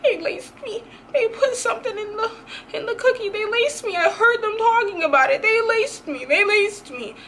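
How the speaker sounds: sob-laugh it in the bathroom; crying. Retold or imitated. Crying on the toilet that she was laced, in a high tearful wail. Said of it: "I started crying at one point".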